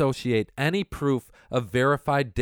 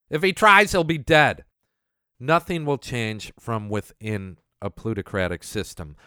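ordinary talk, nothing marked

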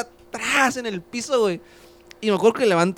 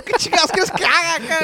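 higher, exasperated snap back; annoyed retort; 9 semitones higher, 3 dB louder than usual. Retold or imitated. Kid snapping back at his older brother, exasperated. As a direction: fire it back, irritated.

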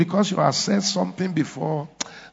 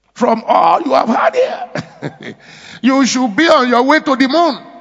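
louder, higher, building to a cry; full-force preaching; 5 semitones higher, 3 dB louder than usual. Acted. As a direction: hammer the promise home to the church.

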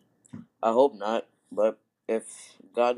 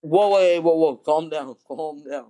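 loud whoa; 4 semitones higher, 7 dB louder, slower than usual. Real, not acted. Stopping friend, loud.

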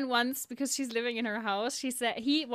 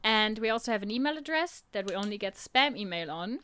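ordinary delivery

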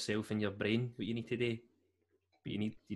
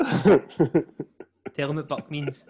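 laughing tease; 4 semitones higher, 12 dB louder, much slower than usual. Real, not acted. Teasing friend, drawn out laughing.

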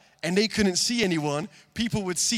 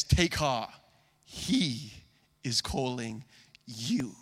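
tender encouragement; 6 semitones lower, slower than usual. Acted. Word of encouragement to listeners.

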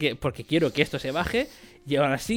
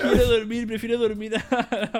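mock whimper; 8 semitones higher than usual. Mock crying, begging to sleep.

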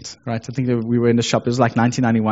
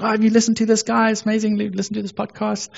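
excited; 8 semitones higher, faster than usual. Retold or imitated. Coworker's message quoted.

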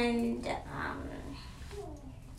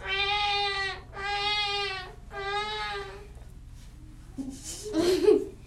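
high, long imitated baby wail; baby crying; 9 semitones higher than usual. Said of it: "it also cried like this".

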